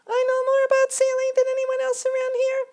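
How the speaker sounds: very high, loud squeaky little mosquito; a tiny self-important ferryman insisting on rules. A narrator's reading. Mosquito ferryman lecturing Grasshopper, very high and loud.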